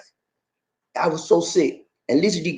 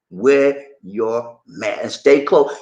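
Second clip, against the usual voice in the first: very slow, lower and deliberate; slow plea; 5 semitones lower, much slower than usual. Real, not acted. Host to viewers urging care, slow and low. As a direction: spell it out slowly.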